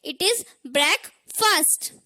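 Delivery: breakfast slowly as "brek - fust"; correct pronunciation. Shown as a demonstration. Teacher asking learners to repeat, breakfast as slow "brek-fust". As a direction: say breakfast split, both vowels short. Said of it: "repeat करें".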